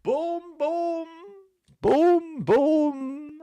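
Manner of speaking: high, loud boom-boom; high-pitched cartoonish punchline cry; comic catchphrase. Comic catchphrase after answer basil.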